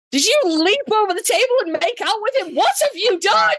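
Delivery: high-pitched, hammed-up incredulous questioning; shocked demand. Friends grilling her on what she did, shocked mock outcry. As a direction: squeal it like freaked-out friends.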